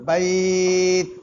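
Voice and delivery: বাইত slow and drawn out, ত ending in a small echoing sound. Shown as a demonstration. Teacher shows the small ত sound to students, slow. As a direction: stretch বাইত and let ত ring a little. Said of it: "ছোট্ট একটা আওয়াজ হবে".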